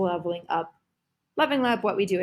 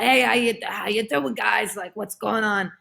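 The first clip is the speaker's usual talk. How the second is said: a lightly voiced loud bro greeting the guys; a hint of a brash dude saying hi; Carl Carlson voice; 2 semitones higher, 3 dB louder than usual. Alter ego greeting the podcast crowd, lightly voiced bro. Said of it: "he talks like this".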